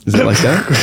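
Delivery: normal talking voice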